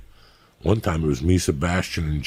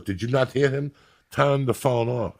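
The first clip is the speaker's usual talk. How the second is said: put-on German accent; 7 semitones higher than usual. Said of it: "There's two German guys sitting next to me".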